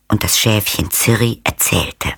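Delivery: ordinary delivery, nothing marked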